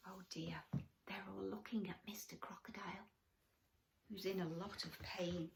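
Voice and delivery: soft whisper of concern; hushed aside. Acted. Storyteller whispers to the children about the crocodile. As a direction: draw them in quietly.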